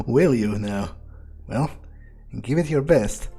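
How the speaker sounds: usual speaking voice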